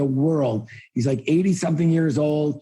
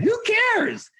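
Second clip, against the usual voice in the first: scoffing. Real, not acted. Dismissing talk of bad economy.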